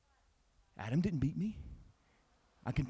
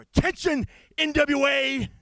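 high shout; 13 semitones higher, 10 dB louder than usual. Acted. Wrestler shouting his challenge to the NWA. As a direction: yell it up high and loud.

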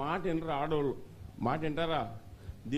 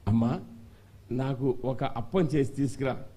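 deep calm prophet; 5 semitones lower than usual. Acted. Elijah asks for bread.

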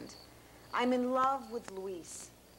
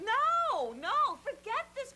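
much higher, urgent protest; alarmed refusal; 10 semitones higher, slower than usual. Acted. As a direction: cut him off, voice jumping up. Maria rejects his advances in alarm.

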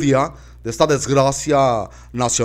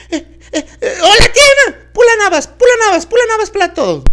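put-on crying child; 10 dB louder, much faster than usual. Child cries while chanting.

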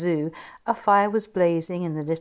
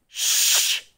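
sharp hissed shush; 5 dB louder than usual. Acted. Jimmy silencing his friends, sharp hiss. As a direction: hush them with a quick hiss. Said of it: "he hissed".

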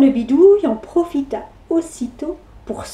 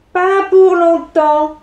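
witch voice; 3 semitones higher, slower than usual. Acted. Witch plotting.

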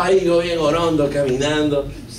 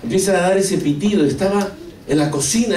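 usual speaking voice

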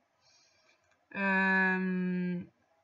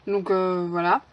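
ordinary talk, nothing marked

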